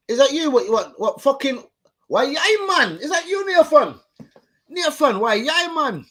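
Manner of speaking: high, loud Geordie impression; hammed-up Geordie voice; Geordie accent. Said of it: "Nathan the Geordie".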